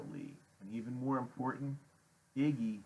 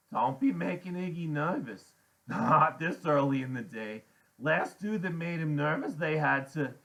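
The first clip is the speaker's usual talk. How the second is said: higher, louder woman character; Loretta's warning; 7 semitones higher, 7 dB louder than usual. A narrator's reading. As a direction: warn them off in a raised voice. Loretta warns the kids.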